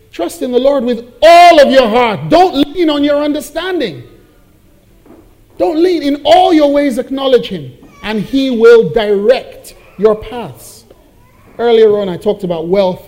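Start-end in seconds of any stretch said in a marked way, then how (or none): 0.1-10.9 proclaimed scripture. Real, not acted.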